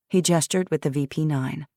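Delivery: ordinary delivery, nothing marked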